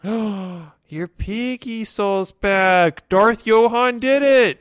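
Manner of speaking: high, slow amazed reveal; awed excitement. Acted. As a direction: gasp it out in wonder.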